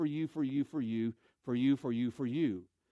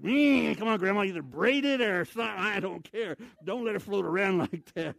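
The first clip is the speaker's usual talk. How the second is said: mock scolding; 3 semitones higher than usual. Scolding grandma's armpit hair on bus.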